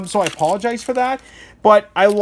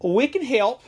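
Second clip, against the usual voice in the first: lightly done Southern American drawl, higher; mock Southern accent; 7 semitones higher, slower than usual. Imitating Rubbermaid company in light Southern accent. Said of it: "my default is just, it's Southern for America".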